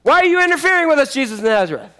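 high, fast outburst; sudden high-pitched demonic protest; possessed man shouting. Acted. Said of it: "evil spirit began shouting".

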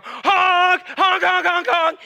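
loud high honks; mock horn honk. Pastor honking at the church to get it going, loud mock honks. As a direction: blare at the congregation. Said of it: "what I wanna do for the church is go".

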